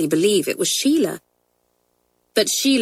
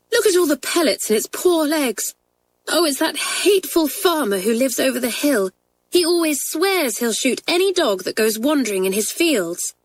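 put-on high, indignant girl's voice; outraged on the dog's behalf; 7 semitones higher than usual. A narrator's reading. Kathleen tells the twins about the cruel farmer, indignant. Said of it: "cried Kathleen indignantly".

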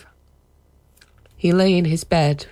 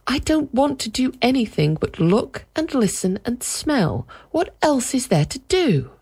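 put-on spoiled child voice; 10 semitones higher than usual. Peter's voice.

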